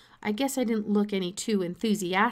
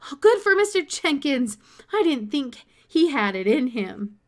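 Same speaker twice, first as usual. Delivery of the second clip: much higher and louder, gleeful; delighted; 5 semitones higher, 7 dB louder than usual. A narrator's reading. Boy cheering the principal's permission to his father, delighted and loud. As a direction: cheer it out, overjoyed.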